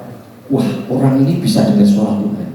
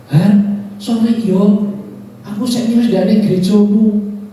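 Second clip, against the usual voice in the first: quoting the man; 11 semitones higher than usual. Retold or imitated. Preacher voices the church-leaving man, apologetic. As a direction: voice him sheepishly excusing himself. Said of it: "Dia ngomong gini".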